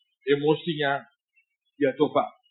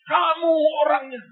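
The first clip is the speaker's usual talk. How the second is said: put-on high, loud trembling cry of the old man; his voice shakes as he points and cries out; 13 semitones higher, 4 dB louder than usual. Old man crying out to the young preacher, trembling. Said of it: "Suaranya berubah jadi gemetar".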